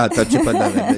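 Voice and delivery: laughing. Real, not acted. Host teasing guest, laughing.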